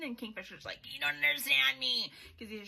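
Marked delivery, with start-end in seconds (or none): 0.9-2.1 loud, hammed-up wounded protest; mock brooding outburst. Kingfisher snapping at Saris after the potion talk, loud mock protest. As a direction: belt it like a sulking hero. Kingfisher's angry retort voiced.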